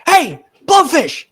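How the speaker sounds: high loud shout across the room; excited call. Acted. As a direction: yell down to him from the railing, drawn out. Little eel calls down from balcony.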